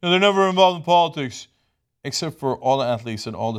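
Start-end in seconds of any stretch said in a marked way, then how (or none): 0.0-1.5 mock critics. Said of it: "They're like".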